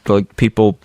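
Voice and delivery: normal talking voice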